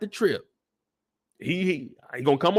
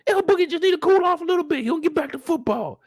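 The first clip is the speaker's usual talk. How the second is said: much higher, shout then fast; snapping father. Retold or imitated. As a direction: cut her off. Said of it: "He's like".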